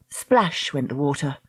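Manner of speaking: plain reading voice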